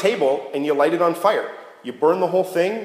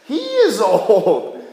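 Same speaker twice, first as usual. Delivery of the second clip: slow emphatic; 5 semitones higher, 4 dB louder, much slower than usual. Acted. Stressing how old Abram is.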